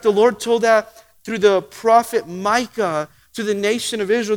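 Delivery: usual speaking voice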